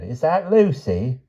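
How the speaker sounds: put-on high, hesitant old lady's voice; timid high-pitched questioning; timid, quavering. A narrator's reading. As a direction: quaver upward in timid disbelief. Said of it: "A timid, quavering voice said".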